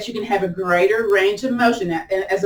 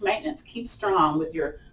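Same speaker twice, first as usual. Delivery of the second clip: normal talking voice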